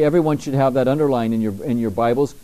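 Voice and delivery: ordinary talk, nothing marked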